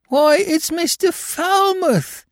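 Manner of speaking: high, slow astonished cry; astonished Cockney crook. A narrator's reading. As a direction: draw it out high in amazement. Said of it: "said the astonished Billy".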